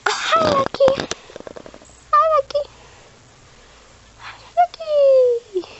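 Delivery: high, loud, slow cooing. Real, not acted. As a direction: squeal sweetly at the pet. Cooing at pet bird.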